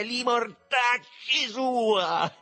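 high, drawn-out savage shout; ferocious yell. A narrator's reading. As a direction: yell it ferociously, stretching the vowels. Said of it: "urlò con una risata feroce". (urlo feroce, acuto e strascicato)

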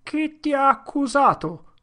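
high, loud challenging cry; shouted. Acted. Voice in the crowd shouting at Potter, high and loud. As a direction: fling the question at him. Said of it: "gridò una voce".

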